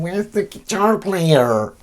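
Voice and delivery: high, slow cat voice; drawn-out, high-pitched pretend cat talk; voicing the cat. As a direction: speak as a whining pet cat. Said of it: "she's been talking about it. She's going".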